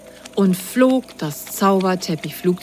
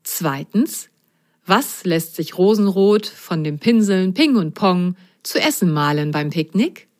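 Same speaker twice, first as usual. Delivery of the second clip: plain reading voice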